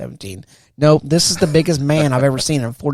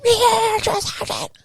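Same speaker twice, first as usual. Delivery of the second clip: very high, strained mock yell; recruit yelling back; slower than usual. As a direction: squeal it out to prove you can yell. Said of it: "did I not yell loud enough".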